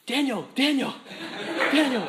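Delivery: loud high call across a room. Retold or imitated. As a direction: call his name out loudly. Calling out across the building.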